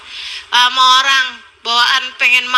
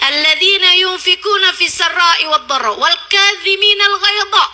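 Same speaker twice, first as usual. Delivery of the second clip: a higher, chanted recitation of the verse; Quranic recitation; 5 semitones higher than usual. Acted. Preacher recites the verse to the women, chanted. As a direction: recite the Arabic verse with a lilt.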